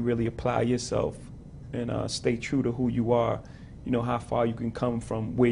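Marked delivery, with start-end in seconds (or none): none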